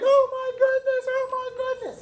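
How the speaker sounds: high, loud mock squeal of shock; freaking out. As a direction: imitate a student shrieking in a frenzy. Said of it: "freaking out".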